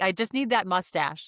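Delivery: ordinary delivery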